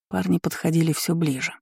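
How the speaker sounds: ordinary delivery, nothing marked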